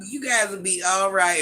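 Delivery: ordinary talk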